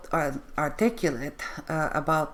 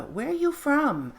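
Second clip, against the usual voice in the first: much higher-pitched quoted question; imitated stock question; 10 semitones higher than usual. Quoting the question we get a lot, high-pitched imitation. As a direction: swoop up high on the question, then fall. Voices the question people always ask.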